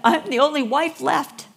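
playful mock boast, stress on "only"; teasing boast. Teasing her husband, playful boast stressing "only". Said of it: "I tell Brian all the time".

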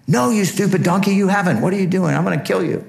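hammed-up fury at a stubborn animal; Balaam yelling at his donkey in high mock anger; angry Balaam.

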